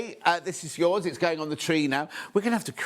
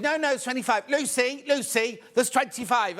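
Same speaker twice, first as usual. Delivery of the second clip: higher voice appealing to someone for backup; high-pitched mock protest; 7 semitones higher than usual. Colleague calling on Lucy to back her up, mock insistent.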